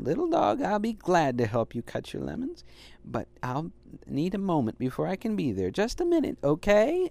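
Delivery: a hint of a cheerful mother; lightly voiced Mom. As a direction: answer the puppy kindly. Mom's voice in story.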